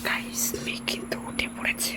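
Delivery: hushed whisper. Real, not acted. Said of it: "কথা বলা যাবে না".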